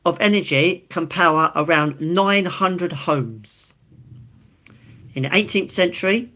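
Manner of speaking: ordinary delivery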